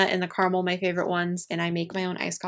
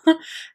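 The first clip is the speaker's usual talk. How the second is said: nervous laugh; 9 semitones higher, 5 dB louder than usual. Real, not acted. Nervous laugh before emotion.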